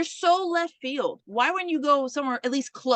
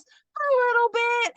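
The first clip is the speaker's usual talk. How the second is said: put-on little girl; 11 semitones higher, 4 dB louder than usual. Little girl voiced in story.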